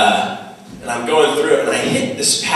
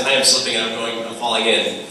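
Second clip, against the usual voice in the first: ordinary talk, nothing marked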